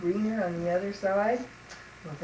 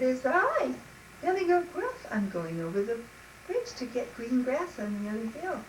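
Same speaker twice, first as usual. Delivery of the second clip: higher, small goat voice; little billy goat; 5 semitones higher than usual. Acted. Little goat answers the troll.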